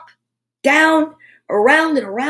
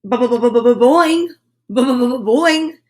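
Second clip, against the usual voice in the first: louder bouncy springing boings; boing sound effect; 4 dB louder than usual. A narrator's reading. Narrator making the hair spring with boings.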